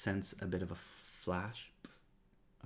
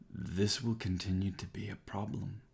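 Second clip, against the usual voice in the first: lightly voiced ancient tree, grave and caring; Thorn Mother speaking; 3 dB louder than usual. Thorn Mother tells the player why they were spared, lightly voiced.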